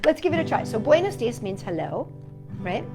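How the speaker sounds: ordinary delivery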